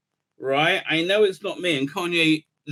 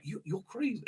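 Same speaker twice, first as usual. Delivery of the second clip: much softer, laughing under it; mock Alex Jones; 3 semitones higher, 13 dB quieter than usual. Imitating Alex Jones calling a guest crazy, soft mock aside. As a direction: say it half-laughing, almost under breath. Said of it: "Alex Jones was like".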